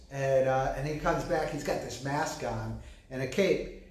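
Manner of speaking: usual speaking voice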